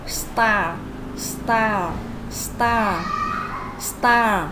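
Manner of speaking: "star" read out clearly for students to repeat, falling each time; teacher model word. Shown as a demonstration. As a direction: model "star" slowly enough to copy. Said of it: "the first word".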